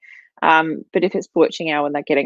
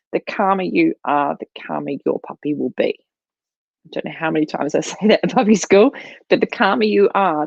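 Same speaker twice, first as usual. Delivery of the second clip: ordinary talk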